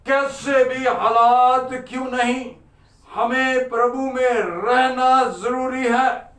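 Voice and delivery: slow, rhythmic preaching with stretched words; fervent preaching. Real, not acted. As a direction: drive each short phrase home to the congregation. Exhorts the congregation rhythmically. (जोशीला, लयबद्ध उपदेश)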